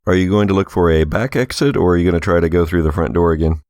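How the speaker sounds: ordinary talk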